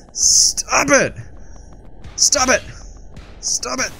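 mock squealed protest, very high; high-pitched protest. Gamer telling enemies to quit, high mock squeal. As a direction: squeal it in short repeated bursts.

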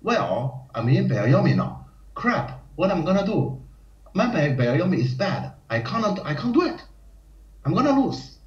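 rising into a high, whiny mock panic; panicking player. Player stuck in byoyomi panicking to himself, mock panic rising high. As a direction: fret aloud, spiralling upward in pitch. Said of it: "many people actually says".

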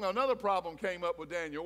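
ordinary delivery

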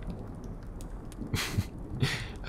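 light self-amused chuckle; quiet amused laugh; soft laugh. Acted. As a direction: chuckle at yourself. Laughs at own food craving.

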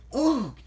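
loud, high groan. A narrator's reading. Emily woken by Thomas, loud groaning. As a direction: groan out in protest at being woken. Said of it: "Emily groaned".

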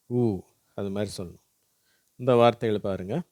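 normal talking voice

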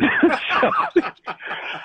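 high, airy laugh. Real, not acted. Laughing at the story.

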